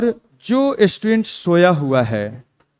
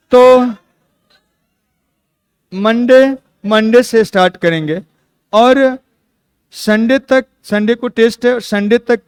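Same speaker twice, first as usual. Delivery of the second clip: emphatic call; 5 semitones higher, 5 dB louder than usual. Real, not acted. Teacher rallying class for Monday.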